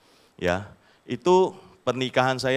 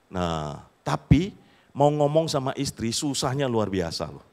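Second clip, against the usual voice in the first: usual speaking voice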